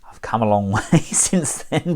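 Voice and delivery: chuckling through the words; amused, laugh breaking in mid-phrase. Real, not acted. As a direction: let a chuckle slip into the line. Laughs at own early reviews.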